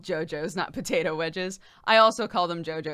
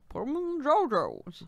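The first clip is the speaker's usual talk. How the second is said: put-on thick Southern accent; 7 semitones higher than usual. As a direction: mimic the bayou cook's thick accent. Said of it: "a real thick accent or something".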